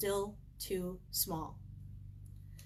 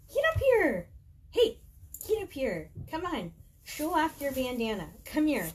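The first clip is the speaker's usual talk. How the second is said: much higher, louder sing-song pet voice; coaxing the dog; 7 semitones higher, 6 dB louder than usual. Real, not acted. Speaker coaxing her dog Bella into the camera, high cooing pet voice. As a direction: call the dog up sweetly and eagerly.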